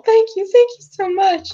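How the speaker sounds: high-pitched, tearful mother; tearful thanks. Acted. Mother reunited with her son, tearful gratitude. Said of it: "she's just tearful".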